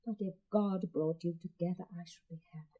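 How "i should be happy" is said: breathed, unvoiced; whisper. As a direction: barely whisper it.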